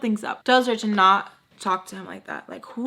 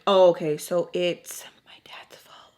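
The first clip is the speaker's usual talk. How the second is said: loud "oh okay" then a hushed whispered realization; stunned whisper; 3 dB louder than usual. Retold or imitated. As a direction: drop to a whisper as it sinks in. Sam realizing her dad's fault.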